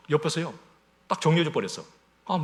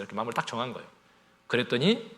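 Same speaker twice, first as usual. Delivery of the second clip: ordinary talk, nothing marked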